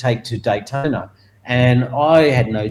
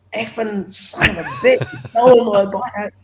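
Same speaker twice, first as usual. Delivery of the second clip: higher, mock outraged curse; imitating furious owner; 9 semitones higher than usual. Bike owner on the grandstand cursing the thief, mock furious. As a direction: spit it out like a robbed man. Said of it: "he was furious up on the grandstand".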